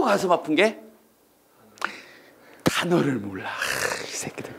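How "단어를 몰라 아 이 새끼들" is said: whispered, frustrated curse; muttered curse. Retold or imitated. A student whispering a curse at unknown words. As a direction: breathe the curse under your breath. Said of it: "이러면서".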